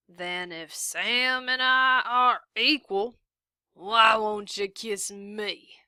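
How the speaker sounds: slow, breathy drawling taunt; leering taunt. A narrator's reading. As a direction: tease her with mock logic.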